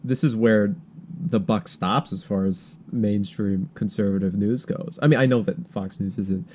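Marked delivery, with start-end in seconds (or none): none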